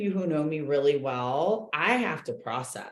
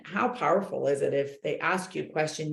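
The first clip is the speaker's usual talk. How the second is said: ordinary talk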